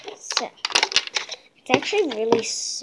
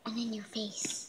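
whispering; 3 semitones lower, 16 dB quieter than usual. Real, not acted. Kid whispering while filming.

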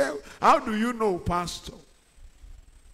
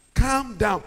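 normal talking voice

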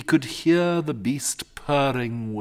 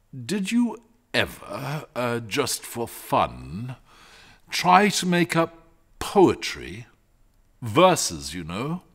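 bashful; 5 semitones higher, 4 dB louder, slower than usual. A narrator's reading. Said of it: "he said bashfully".

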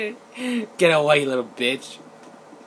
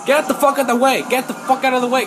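repeated yell, higher and louder; angry shouting; 7 semitones higher, 7 dB louder than usual. Real, not acted. Gamer yelling at cars blocking the road, repeated shout. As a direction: bark it at the traffic again and again.

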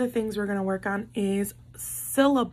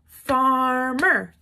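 far-mer split in two beats, a bit louder; syllable clapping; 5 dB louder than usual. Shown as a demonstration. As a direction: count the claps on farmer. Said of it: "let's count how many times we clap".

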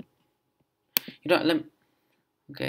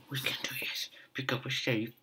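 whisper; 12 dB quieter, faster than usual. Real, not acted. Muttering to self while playing.

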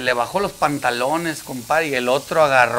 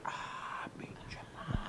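whispered mock insult from the crowd; muttered grumble; 22 dB quieter, much slower than usual. Passenger whispering an insult at the magician. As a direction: grumble it under your breath at the magician.

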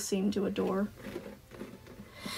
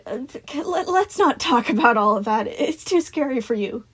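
stammering start in a raised voice; stumble, then coax them off the subject; Sun, nervous deflecting; 4 semitones higher, 4 dB louder than usual. A narrator's reading. Sun steering children from scary talk, nervous.

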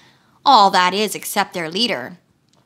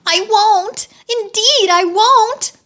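high eager promise; wheeler promising; 11 semitones higher, 4 dB louder, slower than usual. A narrator's reading. Wheeler promises Dorothy, high and eager. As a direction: squeal out the promise. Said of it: "promised the wheeler".

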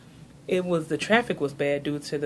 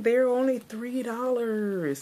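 high and slow, marvelling at the price; thrilled bargain hunter, pitched way up; delighted disbelief; 8 semitones higher, slower than usual. Retold or imitated. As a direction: savour every word like a steal. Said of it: "I was like".

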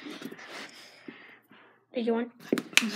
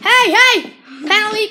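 calling out hey; 5 semitones higher, 9 dB louder, slower than usual. Real, not acted. Kid refereeing calls out to players.

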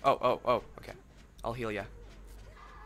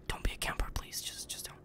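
whispered softly, much softer; playful whisper; 12 dB quieter than usual. Pretend. Vlogger teasing his viewers at the end, playful whisper. Joking plea in outro.

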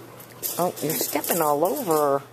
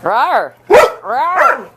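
loud high mock roar; playful monster growl; 9 semitones higher, 11 dB louder than usual.

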